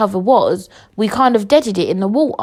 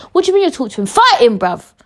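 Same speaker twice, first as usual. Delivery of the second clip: high, incredulous retelling; exasperated retort; 5 semitones higher, 4 dB louder, faster than usual. Retold or imitated. Podcaster rejects Lidl offering to talk, exasperated. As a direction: snap back in mock outrage, pitched up.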